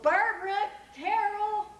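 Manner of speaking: put-on loud, high holler for the kids; calling children. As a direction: sing out each name to carry across the neighborhood. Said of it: "then Mister Fitch called".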